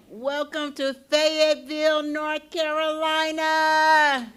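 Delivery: loud, high, drawn-out welcome holler; rousing welcome call. Real, not acted. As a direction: call it out big to the crowd, stretching Carolina. Rousing welcome to the conference crowd.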